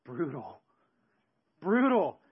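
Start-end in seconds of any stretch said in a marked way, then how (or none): 1.6-2.2 loud emphatic. Shown as a demonstration. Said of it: "word repeated, second one loud".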